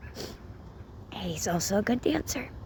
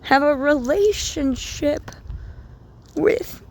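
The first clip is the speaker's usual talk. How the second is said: fervent retold reply; 8 semitones higher, 4 dB louder, slower than usual. Voicing God's encouraging reply.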